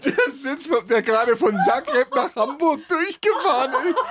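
squealing with laughter; laughing. Real, not acted. Cracking up over the line.

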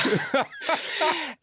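a real, high burst of laughter; bright laugh. Real, not acted. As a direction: laugh it off, amused.

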